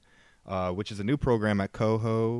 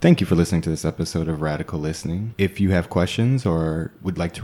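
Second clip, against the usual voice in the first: podcast outro; 2 semitones lower, 7 dB louder than usual. Acted. Recorded outro read.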